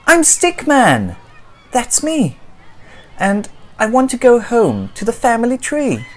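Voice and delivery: high, loud pleading protest; exasperated little stick figure; frustrated Stickman. Acted. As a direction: insist on who you are.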